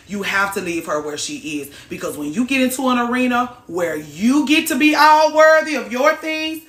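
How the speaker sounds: ordinary talk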